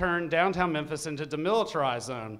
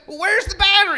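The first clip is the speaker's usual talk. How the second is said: high, loud irritated question; 12 semitones higher, 10 dB louder, faster than usual. Retold or imitated. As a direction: demand an answer. Leader asks.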